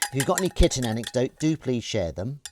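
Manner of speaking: coaxing a kitten with a sing-song voice; cutesy pet voice. Acted. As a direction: talk to viewers the way you'd talk to the kitten. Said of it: "Don't know why I'm speaking in that voice".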